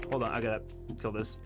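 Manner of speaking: ordinary talk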